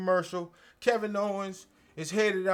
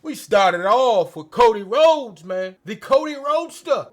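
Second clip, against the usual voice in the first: usual speaking voice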